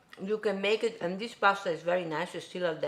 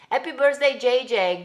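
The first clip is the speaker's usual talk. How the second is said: excited birthday wish; 6 semitones higher, 10 dB louder than usual. Real, not acted.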